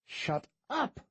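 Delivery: the guide yelling at the priest, barking; mild shout. A narrator's reading. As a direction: cut the priest off. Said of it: "shouted the taller of the guides".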